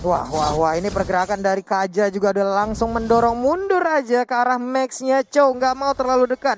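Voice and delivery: ordinary talk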